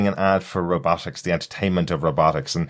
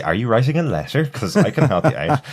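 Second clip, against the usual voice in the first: higher, eager helper voice; chirpy paperclip assistant; 6 semitones higher than usual. Retold or imitated. Co-host mimics the old Windows paperclip, eager and high. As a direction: pitch it like a pushy cheerful pop-up.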